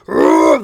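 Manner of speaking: a loud, low, strained mock "ugh" of pain; hammed-up groan of a player fishing for a foul; mock scream. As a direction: fake a player's anguished groan at the refs. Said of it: "They are screaming".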